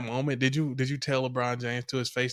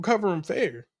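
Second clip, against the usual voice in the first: normal talking voice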